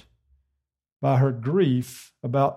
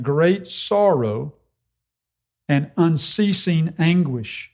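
great and unceasing drawn out slowly; slow emphasis on adjectives; slower than usual. Shown as a demonstration. Preacher dwelling on Paul's words, slow stress. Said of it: "each noun modified by an adjective".

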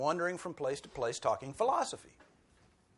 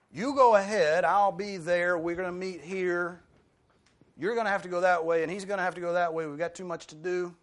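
raised, higher voice, urgently organizing everyone; busy disciples calling out; 4 semitones higher, 6 dB louder than usual. Acted. Busy disciples splitting up to cover the work, calling out urgently. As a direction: call out hurried plans to the group.

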